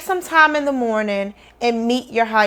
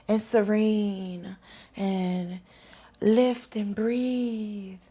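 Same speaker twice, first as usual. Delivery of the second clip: very slow, much softer calm meditation voice; mock serene teacher; 2 semitones lower, 10 dB quieter, much slower than usual. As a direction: parody the ever-tranquil YouTube guide. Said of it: "that was their tone the entire time".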